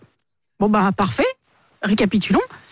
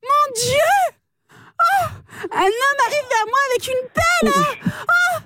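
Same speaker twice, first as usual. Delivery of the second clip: high, overacted panic over a man with a shovel; hammed-up terror; 10 semitones higher, slower than usual. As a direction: ham up the fright like a telenovela. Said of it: "C'est tellement mal joué". (terreur surjouée de télénovela, voix aiguë)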